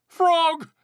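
very high, loud call; storybook holler of distress; loud cry. A narrator's reading. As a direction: call out desperately for a friend. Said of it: "cried the thing".

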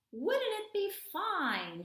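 high, slow, sly duck voice; dreamy duck. Acted. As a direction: daydream aloud as a scheming little duck. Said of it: "he murmured".

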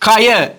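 கய said with a hard k in place of h; hard-k slip on கய; common mispronunciation. Retold or imitated. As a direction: start கய on k, as learners wrongly do. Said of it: "என்று உச்சரிக்கிறீங்க".

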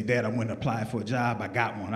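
put-on low, quiet kid on the phone; lightly voiced son calling his dad; son's voice. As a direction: mumble it like a sheepish son.

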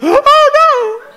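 high, loud anguished wail; high loud mock anguish; distraught cry. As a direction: cry out in overwhelming sadness. Sad for crying woman.

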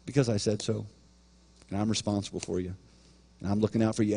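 low, soft, firm parent voice; quiet parental finality. Acted. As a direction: lay down the rule quietly, pitch dropped. Parent laying down a rule.

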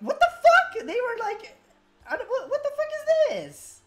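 loud, high disbelief; shocked outburst. Real, not acted. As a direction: yell in confused shock. Shocked at game.

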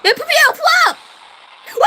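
high, loud exclamation; excited shout. Real, not acted. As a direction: yell it out in triumph. (신나서 높게 외치기)

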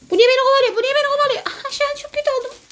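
panicked high whine; frantic, voice cracking high; being chased. Real, not acted. Character chased in game.

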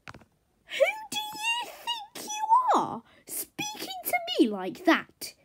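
very high, drawn-out indignant wail; hammed-up high-pitched offence; haughty outrage. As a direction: squeal it slowly, utterly affronted. Haughty horse outraged at scolding.

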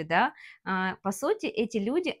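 ordinary talk

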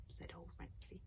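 plain reading voice

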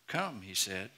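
ordinary delivery

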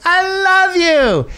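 very high, slow, drawn-out love; mocking a drunk friend's embrace; gushing drunk woman. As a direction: swoon it like a sloppy hug. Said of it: "one of them is like".

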